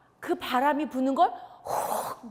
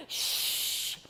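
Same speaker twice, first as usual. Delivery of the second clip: unvoiced hissing gust of wind. Storyteller making the wind's hiss for children. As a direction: whoosh like a storm wind over the sea.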